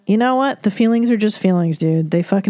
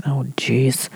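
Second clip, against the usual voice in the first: whispered oh jeez; 6 semitones lower, 4 dB quieter than usual. Real, not acted. Reacting to the cheating letter.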